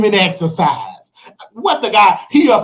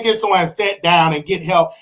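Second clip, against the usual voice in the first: normal talking voice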